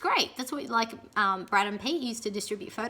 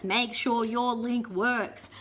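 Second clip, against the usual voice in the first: slow, even repeat; slow parroted rule; slow repeated advice; much slower than usual. Retold or imitated. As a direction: drill it in slowly. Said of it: "Everything you read about audition videos says".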